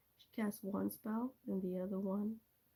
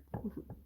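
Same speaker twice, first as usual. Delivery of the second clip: short, quiet breathy laugh; a small laugh under the breath; soft chuckle; 6 dB quieter than usual. Real, not acted. Laughing lightly to viewer about how weak she sounded, soft chuckle. Laughs after remark on own voice.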